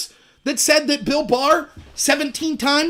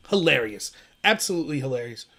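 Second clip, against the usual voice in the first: usual speaking voice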